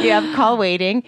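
ordinary delivery

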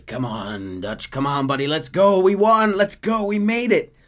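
eager urging. Retold or imitated. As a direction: coax Dutch excitedly. Micah urging Dutch.

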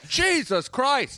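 high, drawn-out exasperated cry; hammed-up furious director; director's temper. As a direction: exclaim it slowly at high pitch. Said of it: "the infamous Gary's temper".

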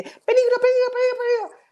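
very high, loud alarm squeal; reptile brain voice. Retold or imitated. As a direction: shriek the warning like a panicked lizard. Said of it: "es tener el reptil acá diciéndote". (voz muy aguda de reptil alarmado)

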